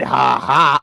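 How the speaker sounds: loud taunting ha ha; Nelson's mocking ha ha. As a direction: jeer it like a cartoon bully. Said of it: "that does a terrible Nelson".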